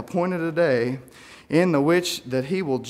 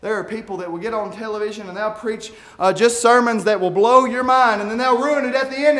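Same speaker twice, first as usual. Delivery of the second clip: ordinary talk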